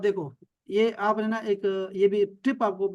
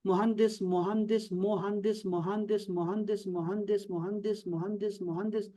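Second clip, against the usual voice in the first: repeated steadily, fading softer; repetition drill; 5 dB quieter, much slower than usual. Shown as a demonstration. Teacher repeating a new word on prayer beads, fading drill. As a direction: recite it over and over like prayer beads. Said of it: "چالیس دفعہ تسبیح کے دانوں پہ پڑھوں گا".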